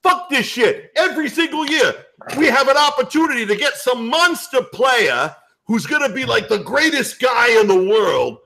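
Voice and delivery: put-on high, worked-up New York accent rant; New York guy. Garden regular griping about draft picks, worked-up New York accent. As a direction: rage like a long-suffering New York fan.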